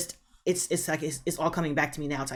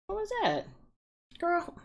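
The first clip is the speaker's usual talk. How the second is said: real startled squeak, much higher; 14 semitones higher, 4 dB quieter than usual. Real, not acted. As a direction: jumps at a sudden noise, voice shooting up. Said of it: "I don't know what that was".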